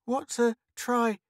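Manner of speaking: put-on high, slow struggling little girl; with difficulty. A narrator's reading. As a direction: sound out a hard word, high and halting. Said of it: "said Anna with difficulty".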